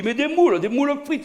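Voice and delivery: very high incredulous outburst; comic high-pitched indignation; exasperated insistence. Retold or imitated. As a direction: insist as if it were obvious. Exasperated food demand.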